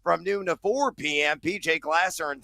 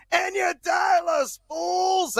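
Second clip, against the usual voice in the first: shouting; 13 semitones higher, slower than usual. Acted. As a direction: yell it at the listeners. Host barks call-in number.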